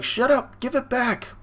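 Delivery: high-pitched mock kid yell; imitated childish outburst; angry kid. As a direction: protest like a bullied little boy. Young Sanji yells at brothers.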